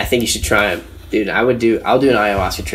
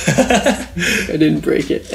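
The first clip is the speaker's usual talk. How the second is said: drawn out very slowly, higher, laughing; slow laughing repeat; 7 semitones higher, much slower than usual. Retold or imitated. Replaying the punchline to his friend, slow and laughing. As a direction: savour the punchline through laughter.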